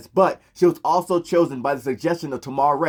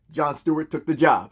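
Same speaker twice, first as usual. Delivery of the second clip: ordinary talk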